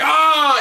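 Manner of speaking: loud, high-pitched pained "ah" scream; mock scream. Officer hit on the knee by a baton, screaming. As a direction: imitate your own cry of pain, high and loud. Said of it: "him and I are both parallel screamers".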